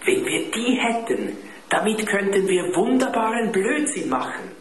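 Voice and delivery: put-on high, eager voice of a scheming boy; mischievous excitement. A narrator's reading. Dieter schwärmt vom Unfug. (aufgeregt und verschmitzt, hoch)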